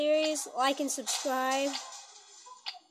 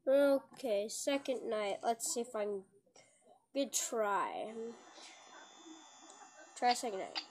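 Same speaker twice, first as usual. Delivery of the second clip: usual speaking voice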